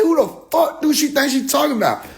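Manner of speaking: who does she think she is; mock outrage. Speaker retorting about the woman, indignant and high. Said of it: "I said".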